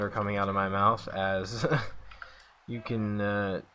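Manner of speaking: low, quiet, deflated commentary; dejected, voice sunk down; frustrated. Real, not acted. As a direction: keep calling the game with the spirit knocked out of you. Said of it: "hear the frustration in my voice".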